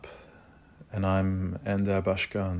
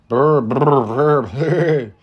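lips fluttered like a horse snorting; loud, playful horse lip trill; horse noises; 6 semitones higher, 10 dB louder, much slower than usual. Pretend. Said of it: "What noises do horses make".

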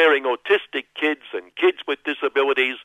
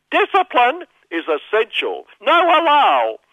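dominatrix voice; 11 semitones higher, 5 dB louder than usual. Pretend. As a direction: bark it out like a strict madam. Voicing Madam Lash character.

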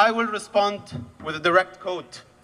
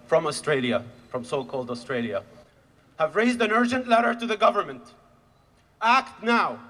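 usual speaking voice

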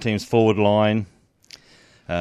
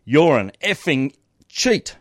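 mild yelled accusation; mock yell at umpire; 5 semitones higher, 4 dB louder, slower than usual. Player yelling at the umpire, mock angry. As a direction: shout it at the umpire, half-acted. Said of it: "He yells at the umpire".

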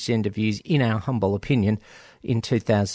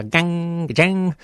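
twanging guitar-strum imitation, drawn out, higher; imitated guitar; 4 semitones higher, much slower than usual. Shown as a demonstration. Host explaining the band's name, as a guitar sound. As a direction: stretch it out like ringing guitar chords. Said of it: "from the sound of a guitar".

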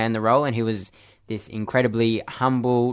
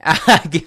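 embarrassed laugh; 6 semitones higher, 8 dB louder than usual. Real, not acted. Laughs before being put on spot.

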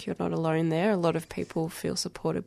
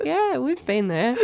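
a high, laughing "yeah, we've been there"; slow, amused recognition through laughter; laughing quote; 8 semitones higher, 9 dB louder, slower than usual. Retold or imitated. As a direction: chuckle through a knowing agreement. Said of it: "I'm like".

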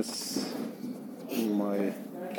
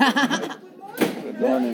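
high sing-song greeting; 12 semitones higher, much slower than usual. Acted. Teacher greets student playfully.

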